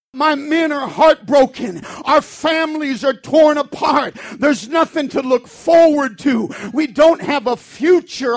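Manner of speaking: loud, fervent sermon delivery; preaching at full force. Acted. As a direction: preach it hard and passionate.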